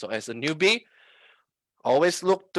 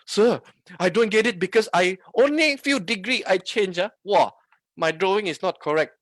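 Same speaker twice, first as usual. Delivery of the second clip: higher, louder mock complaint; blaming student; 4 semitones higher, 5 dB louder than usual. Student blaming the trader coach over a drawing, mock complaint. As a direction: imitate a student protesting to the teacher. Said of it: "one day you blame".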